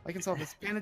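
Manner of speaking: ordinary delivery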